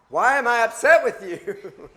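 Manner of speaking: loud, high mock-outraged retort; mock exasperation. Upset wife throwing his apology back at him, mock outrage. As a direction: imitate a fed-up wife, loud and incredulous, laughing after.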